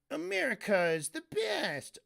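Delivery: high mock-patriotic sneer, dripping with irony; sarcastic voice. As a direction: say it like you don't mean a word. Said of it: "like, in a sarcastic voice".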